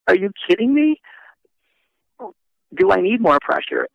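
high, loud incredulous outcry; retold disbelief. Trent reacting to the all-about-you news, high incredulous. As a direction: pitch up in mock disbelief.

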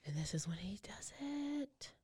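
breathy hushed whisper. Real, not acted. Host whispers to cohost at the killing, hushed whisper. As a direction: drop to a whisper at the grim moment. Listener reacts during murder story.

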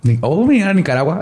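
jumps up high in surprise at the name; a bright startled oh, then the name; surprised. Real, not acted. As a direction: caught off guard by a familiar place. Sudden news of Nicaragua.

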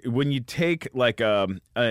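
ordinary talk, nothing marked

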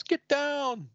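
very high-pitched warning cry, little added volume; mock shout. Inferno warning the team under fire, high mock shout. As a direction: yelp it like an urgent warning.

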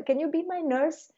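put-on high, eager child asking; sweet toddler plea; child asking parent to play, high and eager; little kid voice.

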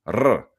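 R as a rolled tongue trill. Shown as a demonstration. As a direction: roll the R on the tip of the tongue. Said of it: "they trill it".

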